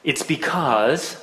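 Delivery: "because" drawn out slowly, then left hanging; suspenseful pause. A narrator's reading. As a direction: stretch "because" and stop as if thinking. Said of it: "and then she paused".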